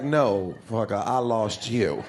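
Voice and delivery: low, slow, deadpan; sarcastic low retort; mock sarcasm. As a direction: drag it out, lying to them on purpose. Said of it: "You're like".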